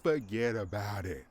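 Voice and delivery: lightly voiced New Jersey wiseguy brushing it off; a hint of a gruff New Jersey mobster; mock New Jersey dismissal; New Jersey tough guy. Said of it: "an angry fat man from Jersey".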